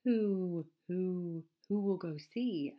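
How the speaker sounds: loud, high, drawn-out owl hoots; questioning owl. A narrator's reading. Owl asking who will go see the stranger, hooting. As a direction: hoot each opening word, falling away. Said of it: "asked the owl of many questions".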